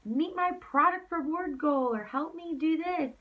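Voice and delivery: high, mock-pushy sales pitch; pushy salesy voice. Downline mocking a salesy recruit's pitch, high and whiny. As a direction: whine out a begging sales plea. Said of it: "If they're being, you know, super salesy".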